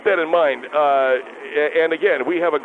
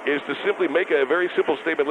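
normal talking voice

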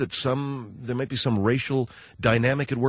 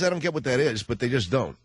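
usual speaking voice

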